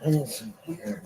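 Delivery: whispered, out of breath; barely voicing it, needing air; breathless whisper. Real, not acted. Short of breath, asks for air.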